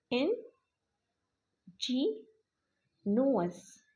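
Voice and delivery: very slow "Angénieux", split in-jee-nuus with long gaps; drawn-out syllables. Shown as a demonstration. Teacher sounding out the word while writing strokes, very slow "Angénieux". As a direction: stretch and separate each syllable of "Angénieux".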